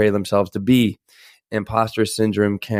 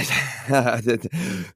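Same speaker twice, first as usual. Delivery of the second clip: nervous laugh; 3 semitones higher, 4 dB quieter than usual. Real, not acted. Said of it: "that's my nervous voice right there".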